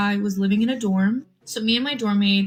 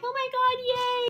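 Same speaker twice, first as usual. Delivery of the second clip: very high mock squealing cheer; imitating screaming. Both dorm floors cheering the match, mock high squeal. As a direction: shriek it like a middle schooler. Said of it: "screaming and being like".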